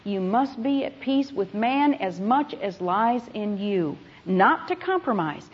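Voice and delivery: normal talking voice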